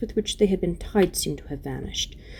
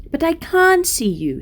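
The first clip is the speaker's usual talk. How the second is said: high protesting; 12 semitones higher, 6 dB louder, slower than usual. Acted. Lucy protesting.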